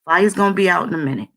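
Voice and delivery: mock easygoing reassurance; laid-back men. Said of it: "They're like".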